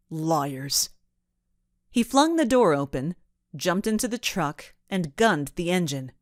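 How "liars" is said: sneering. A narrator's reading. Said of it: "and then sneered".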